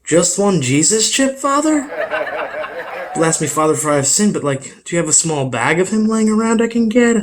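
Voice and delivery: put-on kid at communion. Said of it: "I'd be like".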